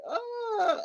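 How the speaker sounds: drawn-out, high-pitched stunned noise; retold gasp of amazement; stunned reaction. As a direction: let out a long high uh of disbelief. Retold reaction to show invitation.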